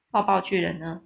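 plain reading voice

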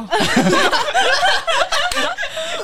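long, very high peal of laughter. Real, not acted. Caught giving away Merlin, a long high-pitched laugh.

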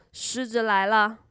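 ordinary delivery

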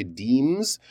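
deems stretched out slowly on the EE; slow deems, long E in the middle; long E sound. Shown as a demonstration. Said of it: "You need that long E sound there".

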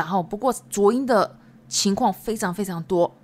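usual speaking voice

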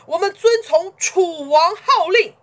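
high, full-voiced proclamation; loud cheer-like shout. A narrator's reading. Lords answering Chu together, loud shout. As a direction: shout it like a crowd pledging allegiance. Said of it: "诸侯们齐声一起高喊".

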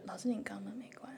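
breathless, voiceless whisper of a student; whispered quote. Retold or imitated. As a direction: whisper it softly like a sly kid. Student's cheeky note read aloud.

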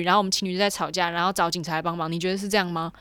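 ordinary talk

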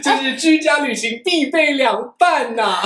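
high squeal, laughing through words. Real, not acted. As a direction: crack up mid-sentence. Laughing comment after reading.

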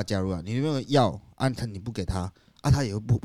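usual speaking voice

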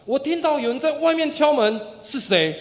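demanding shout at whoever knocks; loud shout. Retold or imitated. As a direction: call out loudly through a door. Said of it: "这次换里面的人大声的喊".

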